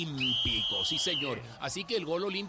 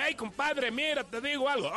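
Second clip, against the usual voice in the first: much higher character voice; second voice; 9 semitones higher than usual. Retold or imitated. One character of the DJ's talking to Magolito, pitched up. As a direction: play the other guy, pitched up. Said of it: "y él mismo decía".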